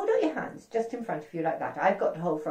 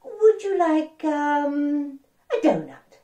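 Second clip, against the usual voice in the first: princess offering; 8 semitones higher, 5 dB louder, much slower than usual. Acted. Princess growing impatient.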